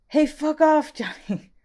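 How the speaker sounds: high, loud yelled movie quote; impersonation of Andrew. Acted. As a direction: bark it out in a high, loud put-on voice. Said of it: "do my best impersonation".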